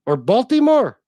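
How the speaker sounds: mock Baltimore accent. Teasing his wife on every Baltimore trip, loud mock Baltimore accent. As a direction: ham up the Baltimore accent with a big shout. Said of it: "I say".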